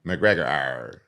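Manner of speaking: low growled arr, lightly put on; a hint of a cartoon tiger growl; mock McGregor growl. Mocking McGregor's jokes to friends, low growl. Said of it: "You sound like Tony the Tiger".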